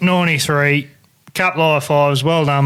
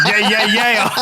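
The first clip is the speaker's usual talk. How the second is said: high, laughing yeah-yeahs; excited cheering; 5 semitones higher, slower than usual. Retold or imitated. Two mates on deck cheering a big fish, giddy and laughing. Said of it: "because I got excited".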